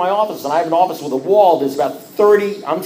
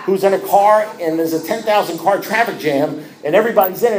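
usual speaking voice

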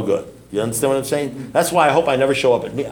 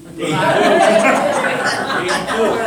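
big laugh; 9 semitones higher than usual. Real, not acted. Speaker laughing at an audience member's wrong answer.

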